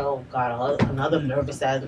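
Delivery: low, quiet retold grumble; weary dismay. Recalling yet another nervous partner, low weary grumble. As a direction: sink the voice, fed up. Acting out her weary inner reaction.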